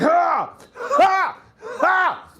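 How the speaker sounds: high, loud hammed-up grunts in a row; rhythmic workout grunts. Guy on the hump machine, loud mock straining grunts. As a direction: grunt each rep loudly. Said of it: "making mad noise for no reason".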